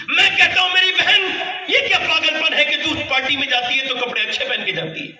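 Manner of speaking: high, loud, shouted sermon lines; full-force preaching. Acted. Preacher exhorting his sisters about greeting husbands, shouted and fervent. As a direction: thunder it at the congregation.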